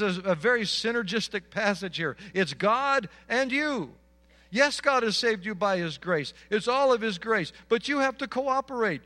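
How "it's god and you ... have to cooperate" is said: loud, higher, driving sermon climax; preacher building to a loud peak; fiery preaching. Acted. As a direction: press the warning home with full force. Preaching urgent exhortation.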